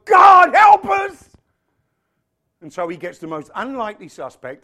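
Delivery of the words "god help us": high, loud, drawn-out shout; desperate cry. Acted. Israelites in bondage calling to God, acted desperate shout. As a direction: cry out slowly at full voice. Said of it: "they suddenly go".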